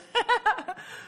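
short high laugh. Real, not acted. Laughs after her own joke.

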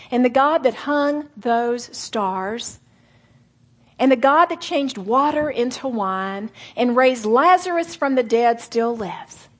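ordinary talk

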